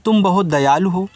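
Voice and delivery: ordinary talk, nothing marked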